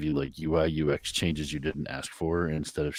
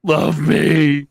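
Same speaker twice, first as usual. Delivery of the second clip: high, loud demanding cry; needy plea; 10 semitones higher, 13 dB louder than usual. Shown as a demonstration. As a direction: beg loudly for love. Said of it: "what I would say".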